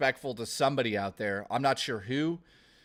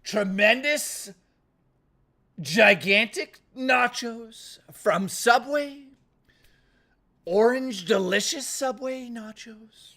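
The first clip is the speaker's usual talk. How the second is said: slow, higher, louder Trump boast; Donald Trump impression; 6 semitones higher, 4 dB louder, slower than usual. Streamer to chat, his Donald Trump: slow bragging. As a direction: sell the nachos like Trump at a rally. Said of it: "here's my Donald Trump".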